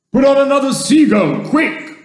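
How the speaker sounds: high, loud command; high-pitched hurried order; urgent shout. A narrator's reading. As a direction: yell for action at once.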